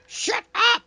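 playful shout. Real, not acted. Playful outburst at friend.

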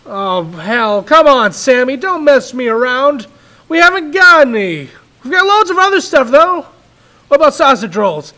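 loud, high, fast frustrated dad; nearly shouting at the boy; exasperated father. Acted. Father losing patience.

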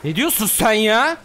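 high, loud and incredulous, slow on hayırdır lan; indignant shout. Real, not acted. Streamer yelling at an enemy who dared to fight him, loud and high. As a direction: yell at the screen in disbelief. Angry outburst at enemy in game.

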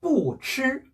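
"뿌" falling strongly before "칠"; fourth-tone 뿌. Shown as a demonstration. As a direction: hit "뿌" with a fourth-tone drop, then "칠". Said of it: "앞에 강하게 읽어주는".

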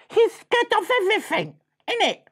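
high squeaky jeer; swaggering little mouse pointing the finger; mocking little brother. Little mouse jeering at Freddy to his bruv, mocking.

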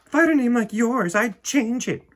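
put-on high, loud bossy little girl; snooty girl. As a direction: look down your nose at her. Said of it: "Victoria said".

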